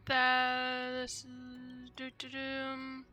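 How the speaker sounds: ordinary talk, nothing marked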